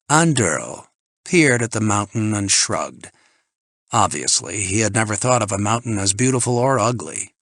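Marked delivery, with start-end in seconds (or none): none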